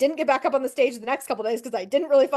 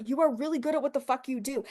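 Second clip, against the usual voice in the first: usual speaking voice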